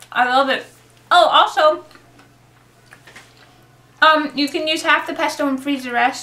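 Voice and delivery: talking through a mouthful; chewing while speaking; mouth full. Real, not acted. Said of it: "Sorry for talking with food in my mouth".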